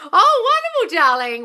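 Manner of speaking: shrieking high "oh wonderful"; delighted. Real, not acted. Host hearing a fan's good news, thrilled high squeal.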